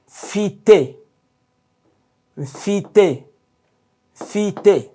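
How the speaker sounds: fite said as FEE-teh, two clear falling syllables. Shown as a demonstration. Teacher models fite for learners, two clean syllables. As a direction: pronounce fite slowly and crisply. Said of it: "Two syllables only".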